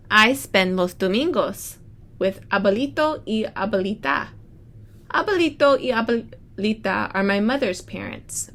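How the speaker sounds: bright storybook read-aloud; reading to children. Acted. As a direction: read it like story time for the class. Teacher reads picture book aloud.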